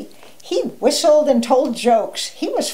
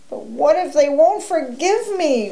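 anxious, higher-pitched question; worried; 6 semitones higher than usual. A narrator's reading. Wolf fears his friends won't forgive him, worried. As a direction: plead with a touch of worry. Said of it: "Wolf worried".

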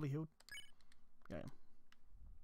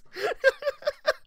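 a high squealing laugh. Real, not acted. Streamer laughs at his own accent slip. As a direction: burst into a high giggle. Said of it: "laughing at his accent".

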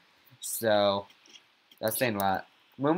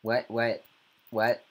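normal talking voice

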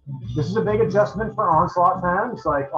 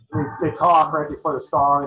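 ordinary delivery

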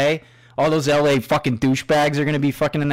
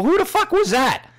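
much higher, indignant mock yell; angry demand. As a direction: demand to know who said it. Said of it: "and I go".

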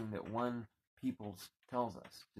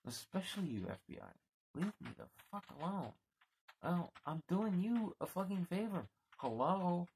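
furious, faster shouting at the FBI; angry outburst; 8 semitones higher than usual. Real, not acted. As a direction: yell it at them, fed up. Ranting at the FBI.

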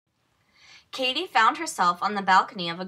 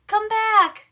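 high, loud call after the frog; 11 semitones higher, 8 dB louder than usual. A narrator's reading. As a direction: shout after someone running off. Called Katie.

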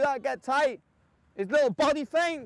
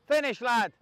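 ordinary talk

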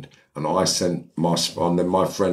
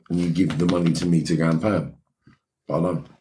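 usual speaking voice

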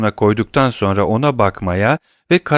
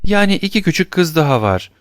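put-on lightly raised, much higher child's voice; raising her voice; 8 semitones higher than usual. A narrator's reading. Little girl speaks up to the stranger, pitched high. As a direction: speak up higher to catch his attention. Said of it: "Cosette sesini yükseltti".

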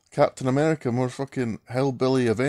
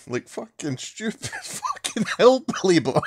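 higher, laughing through the insult; can't stop cackling while mocking; 5 semitones higher than usual. Real, not acted. Laughing at the hillbilly Captain America.

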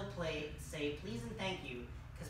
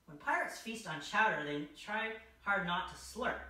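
ordinary delivery

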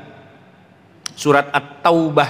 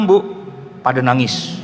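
ordinary delivery

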